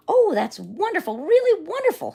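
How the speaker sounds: loud, very high gushing delight; thrilled lion admiring his braid. A narrator's reading. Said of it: "said the lion".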